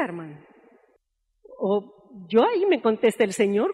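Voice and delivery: usual speaking voice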